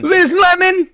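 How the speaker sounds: very high comic call; Tracy Morgan. Acted. Calling a sitcom colleague in an impression, high exclaiming voice. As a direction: cry it out high as a sitcom comedian. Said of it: "pretty good Tracy Morgan".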